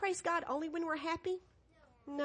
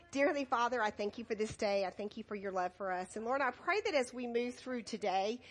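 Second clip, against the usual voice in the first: soft, lower prayer voice; 6 semitones lower, 5 dB quieter than usual. Acted. Children's pastor closing with a quiet prayer. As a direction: pray quietly over the children.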